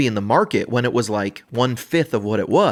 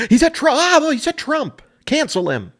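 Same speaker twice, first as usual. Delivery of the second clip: very high, frantic mock outrage; hammed-up high-pitched panic; imitation of outraged person; 3 dB louder than usual. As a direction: shriek it like a scandalized objector. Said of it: "my imitation of people who feel two ways".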